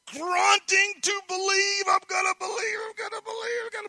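very high, strained, pushed-out whine; mock strained believing. Preacher mocks someone straining to believe, forced whine. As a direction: squeeze the words out as if forcing belief.

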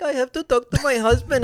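high mock Indian accent. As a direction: imitate the Indian lady's accent. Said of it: "an Indian accent".

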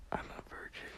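breathy whispered confession, barely audible; whispered aside. Real, not acted. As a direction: drop to a whisper for the punchline. Whispered aside after sex joke.

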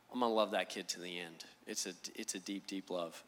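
hushed and sincere, almost to himself; a father's quiet devotion; tender, heartfelt. Real, not acted. Father describing love for son.

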